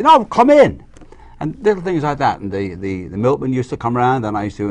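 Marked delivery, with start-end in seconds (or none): 0.0-0.8 high, loud imitation of parents answering; parents' voice. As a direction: call out as the parents at the door. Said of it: "my parents would say".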